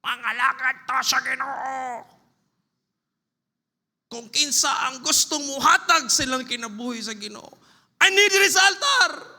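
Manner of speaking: high, loud, fervent preaching; preacher's altar call. Retold or imitated. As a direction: call people forward with fire. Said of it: "Dunay pastor, nagwali siya".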